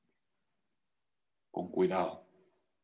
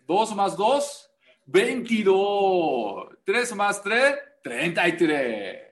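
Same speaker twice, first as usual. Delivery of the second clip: mocking wrong sum; 5 semitones higher, 3 dB louder, slower than usual. Shown as a demonstration. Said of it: "mock false arithmetic".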